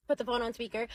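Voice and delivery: plain reading voice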